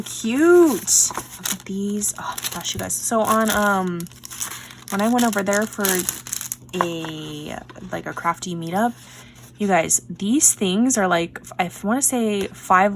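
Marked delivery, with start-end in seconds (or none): none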